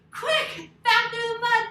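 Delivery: high, loud hurried call; urgent shout. Acted. Story teacher to kids fleeing the bear, urgent. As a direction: urge everyone to flee fast.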